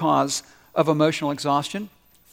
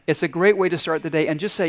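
usual speaking voice

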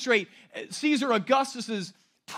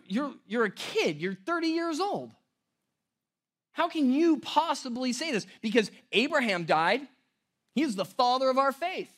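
higher, indignant disbelief; incredulous challenge; 6 semitones higher than usual. Retold or imitated. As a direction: challenge him as the outraged crowd. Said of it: "And they go".

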